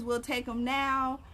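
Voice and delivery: usual speaking voice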